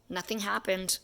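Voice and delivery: usual speaking voice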